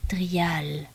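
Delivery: a whispered, understated moan. A narrator's reading. Dying elder calls to his visitor, moaning. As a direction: breathe the name out as a weak groan. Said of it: "gémit Yorick".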